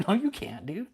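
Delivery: a laughing no, you can't; laughing retort. Real, not acted. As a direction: laugh it out in disbelief. Repeats it, laughing.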